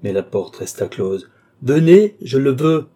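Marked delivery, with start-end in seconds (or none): 1.6-2.9 much louder, high-pitched order; imperious command. A narrator's reading. Count willing Lorenza through the closed door, imperious command. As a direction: force obedience with a loud call. Count insists on being obeyed.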